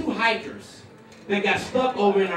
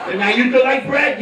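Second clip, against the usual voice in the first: helpless husband; 2 semitones higher, 5 dB louder than usual. Acted. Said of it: "This is me. You're like".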